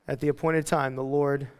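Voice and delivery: normal talking voice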